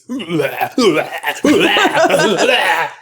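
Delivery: repeated wordless bleh cries; faked fit noises. Faking a vision that night, repeated garbled cries. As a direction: put on a noisy, babbling fit. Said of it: "start to do one of these".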